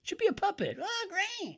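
put-on very high, thin teenage clerk; high cartoonish counter kid; squeaky kid voice. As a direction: pitch it way up like a goofy kid. Ice cream kid voiced in skit.

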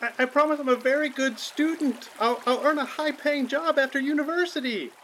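put-on high child's plea; drowning child. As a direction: plead your worth as a kid.

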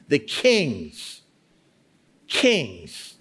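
"king's" set apart by long pauses; emphasizing each word. Shown as a demonstration. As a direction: stop on "king's" and repeat it with weight. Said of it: "stop and emphasize each word".

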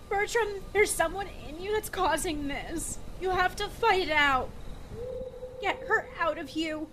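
urgent pleading. Acted. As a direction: push him to fight it off. Begging possessed companion to resist.

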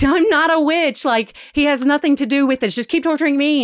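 high, pleading denial; desperate denial. Retold or imitated. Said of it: "And she's like".

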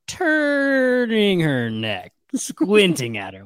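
drawn-out, creaking slow stretch of words; Reverend Mother impression. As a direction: drag it out like a slowly turning neck. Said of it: "you can almost hear the Reverend Mother".

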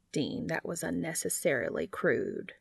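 lightly voiced disapproval. A narrator's reading. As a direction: drop the voice to chide him. Cass reproves Dean, lower voice.

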